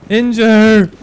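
loud sharp callout; calling out. Real, not acted. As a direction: shout the callout to the team. Callout during fight.

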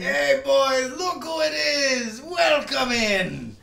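slow, high, welcoming tavern keeper; Hearthstone bartender voice. Pretend. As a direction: greet the regulars like a fantasy innkeeper. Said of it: "my Hearthstone bartender voice".

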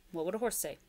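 plain reading voice

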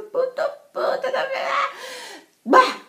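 high, loud mock fury; hammed-up cursing that bursts into a growl; exasperated outburst. Worn out, fed up with the friend, exploding.

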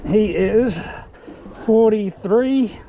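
ordinary delivery

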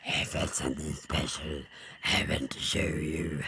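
as low as the voice can go; scary bear in a quiet, bottomless croak. Pretend. Bear revealing something special, very low demonic croak. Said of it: "I can do even lower".

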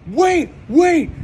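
high, loud urgent wait; mock frantic shout. Hitman halting Tony, a high loud panicked yell. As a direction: yell to stop the shooting.

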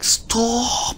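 high squealed imitation of a pestered friend; mock pleading protest. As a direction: cry out high, without extra volume.

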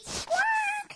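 high shouted bird squawk; loud parrot squawk. Acted. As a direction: screech it like a parrot.

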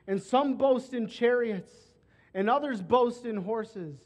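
fervent pulpit crescendo; preaching at full force. Acted. Scripture crescendo, louder.

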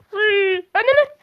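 sudden high yelp of surprise; real startled cry. Real, not acted. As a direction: jump at a scare.

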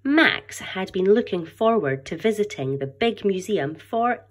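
lively storybook read-aloud to a class; story narration. A narrator's reading. Teacher reading a picture book aloud, animated storytelling. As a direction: read it brightly, voicing each character for the children.

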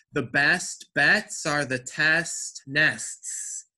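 slow, every T released crisply in "best bets", "test nests"; spaced-out tongue twister, crisp T in "test nests"; hard T. Shown as a demonstration. Teacher models a tongue twister for the class, hard T. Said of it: "You made that hard T".

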